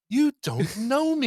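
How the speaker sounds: high, slow, drawn-out quote; acted, affronted comeback; indignant film retort. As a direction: stretch out each word in mock offence.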